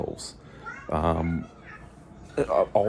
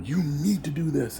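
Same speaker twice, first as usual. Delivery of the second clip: bossy manager; 8 semitones higher than usual. Retold or imitated. Mocks category managers.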